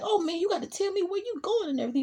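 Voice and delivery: high, fast, panicky pleading; retold begging. Snitch begging Tommy for his life, high and fast. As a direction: beg fast before he gets shot.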